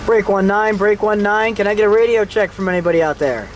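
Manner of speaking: normal talking voice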